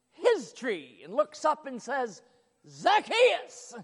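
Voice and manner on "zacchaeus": high, loud call upward. Acted. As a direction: call his name up into the tree. Jesus calls up to tree.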